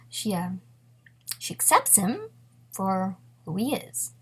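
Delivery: usual speaking voice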